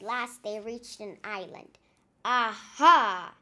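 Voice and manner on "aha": loud booming pirate exclamation; hammed-up pirate aha; triumphant cry. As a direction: bellow it like a delighted captain. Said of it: "cried Captain Pepper".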